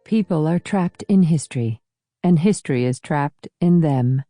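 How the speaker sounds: usual speaking voice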